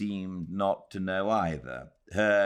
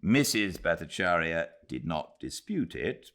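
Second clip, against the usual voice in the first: ordinary delivery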